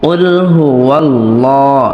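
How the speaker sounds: slow melodic recitation; recited slowly. Shown as a demonstration. Teacher modelling the verse for students, slow chant. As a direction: draw out قل هو الله like tilawa.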